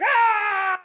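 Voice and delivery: high loud yell; a fighter's shout; mock battle cry.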